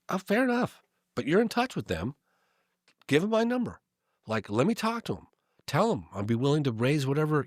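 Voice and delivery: mock-eager asking for a favour; eager pleading. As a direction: push for the contact, all keen and high. Retelling his eager plea to Chuck.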